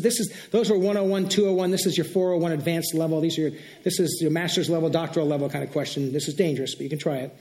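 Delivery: usual speaking voice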